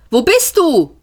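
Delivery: alarmed call into empty air. Acted. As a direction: shout it to a voice you can't see. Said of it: "rief Seifert".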